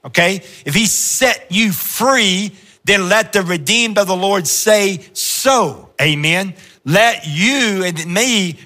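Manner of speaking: rising, fervent preacher voice; full-force sermon delivery; preaching. Acted. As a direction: preach it out to the congregation.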